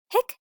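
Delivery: high, loud squeaky hiccups; lamb hiccups. Acted. Lamb with hiccups, loud high hiccups.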